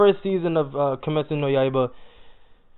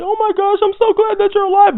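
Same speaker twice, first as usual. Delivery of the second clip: much higher, gushing relieved voice; mock excited relief, pitched way up; imitating Tanjiro's relief; 4 dB louder than usual. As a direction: squeal it like a kid overjoyed to see a friend. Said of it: "and Tanjiro was like".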